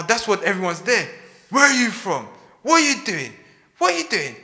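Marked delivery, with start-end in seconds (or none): 1.5-4.4 loud, high, accusing questions; angry questioning. Acted. Angry sailors demanding answers from Jonah, high accusing questions. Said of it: "And you get so angry".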